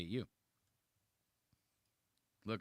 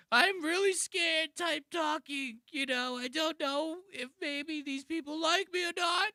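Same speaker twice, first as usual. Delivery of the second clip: very high, exaggerated scared-guy voice; mock insecure guy. Reactor mocking a comedian's insecure shtick, high scared whine. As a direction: mimic an insecure comic, pitched way up. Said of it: "from the fucking insecure guy".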